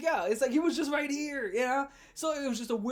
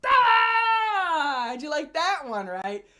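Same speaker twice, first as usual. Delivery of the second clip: loud gleeful shout, then settling; a high triumphant yell that drops back down; taunting yell; 8 semitones higher, 9 dB louder, much slower than usual. Retold or imitated. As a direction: yell it in his face, mocking. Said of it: "And I was like".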